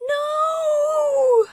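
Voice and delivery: put-on very high, loud child's scream. Mickey screaming as they take her away. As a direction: scream in terror. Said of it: "screamed Mickey".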